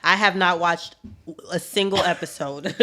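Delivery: normal talking voice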